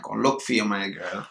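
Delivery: ordinary talk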